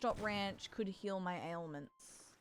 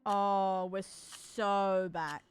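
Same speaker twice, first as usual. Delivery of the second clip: slow drawn-out; 5 dB louder, much slower than usual. Pretend. Triumphant drawn-out exclamation.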